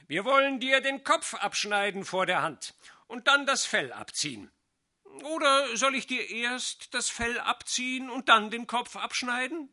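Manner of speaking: loud, high and gleeful, relishing the threat; cheerful villain. A narrator's reading. Cheerful loud gloating. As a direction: sorcerer gloats loudly over the cat he means to kill. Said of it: "sagte wohlgemut".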